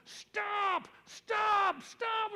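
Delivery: high shouted stop, repeated; alarmed shouts of stop; retold screaming. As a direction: yell stop at a driver in alarm. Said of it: "somebody started screaming at me".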